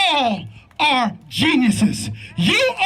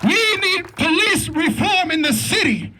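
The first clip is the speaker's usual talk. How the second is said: preaching at full force; 3 semitones higher than usual. Real, not acted. Father addressing rally crowd about pain and police reform, preaching. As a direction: preach it to the crowd, drawing out each line.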